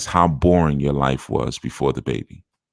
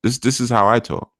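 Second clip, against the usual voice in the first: retold defiance; 7 semitones higher than usual. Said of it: "I said".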